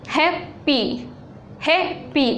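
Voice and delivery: हैप्पी broken into two beats, हैप- then पी; split into syllables. Shown as a demonstration. Teacher breaks हैप्पी into two syllables for students. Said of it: "दो जगह इसको रुक रहा है".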